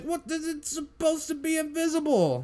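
high, baffled protest; indignant surprise. Retold or imitated. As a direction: sound shocked and puzzled. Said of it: "And they're just like".

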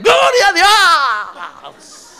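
loud, high, very slow half-laughing cry of Gloria a Dios; mock pained shout. Preacher imitating a stepped-on believer, laughing mock yell. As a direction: yell it as if your foot was stepped on. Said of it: "Dirá usted".

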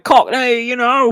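high-pitched, exaggerated Cockney; mock Cockney accent. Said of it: "his accent kept going to Cockney".

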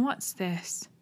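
Holmes drops to a quiet, wary murmur; soft wary question; hushed. Acted. Holmes spots someone in dark.

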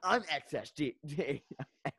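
high, eager anime character voice; perky anime kid intro; overacted anime dub. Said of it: "the way he would talk is like an anime character".

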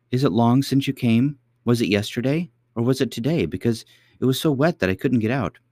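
put-on high eager little girl; cartoon princess. A narrator's reading. Princess questions old lady.

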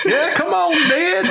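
very high mock Southern voice; high hillbilly mock voice; mock Southern accent. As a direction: egg him on in a hammed-up Southern accent. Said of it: "give them Southern accents".

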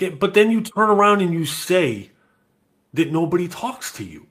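loud, high exasperated parent; mock exasperated dad lecturing his daughter. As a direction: scold your teen in disbelief. Dad retells arguing with daughter.

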